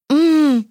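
high-pitched closed-mouth moan; muffled moan. A narrator's reading. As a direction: moan through a covered mouth. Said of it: "промычал мальчишка". (сдавленное мычание)

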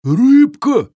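loud, high-pitched troll squeal; hiccuping troll. A narrator's reading. Overfed troll hears about fish, exclaiming with a hiccup. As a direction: burst out high and eager. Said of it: "икнул Грубби".

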